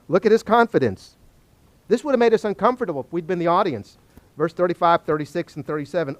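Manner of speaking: normal talking voice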